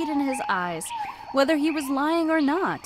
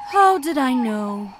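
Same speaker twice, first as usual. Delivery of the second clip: sadly; 7 dB louder than usual. A narrator's reading. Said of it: "said Ostrich sadly".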